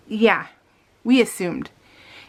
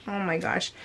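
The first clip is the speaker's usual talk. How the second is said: soft, deflated exclamation; quiet disbelief at more delay; exasperated sigh; 8 dB quieter than usual. Retold or imitated. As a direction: let it drop out, quietly defeated.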